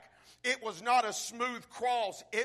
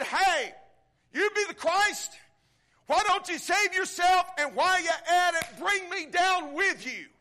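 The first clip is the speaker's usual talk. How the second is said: loud jeering cry, much higher and louder; mocking thief shout; 8 semitones higher, 6 dB louder than usual. Dying thief jeering at Jesus, loud mocking shout. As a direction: hurl it up at him as a scoffing criminal.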